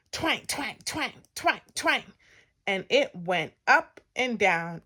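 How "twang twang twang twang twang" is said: high, springy twang noises; bouncy repeated sound effect; springs twanging. A narrator's reading. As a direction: make each twang pop like a spring. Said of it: "and springs".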